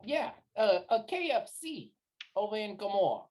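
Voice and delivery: lightly put-on streetwise voice; level with them man to man; folksy wise guy. [name] asks recruiter after a man, confidingly folksy.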